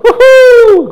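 very high, loud whooping cheer; joyful woo-hoo. Shown as a demonstration. Preacher demonstrating rejoicing noises, loud woo-hoo. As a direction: let out a big celebratory whoop. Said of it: "do a little".

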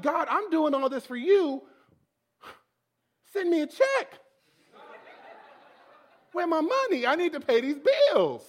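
loud, high comic plea to God; indignant mock demand, rising to a holler. Broke worship leader demanding money from God, indignant.

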